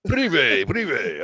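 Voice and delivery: high, put-on calling voice; mock Belarusian caller. Playing a Belarusian truck-driver woman, mock calling out.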